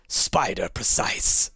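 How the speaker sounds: ordinary delivery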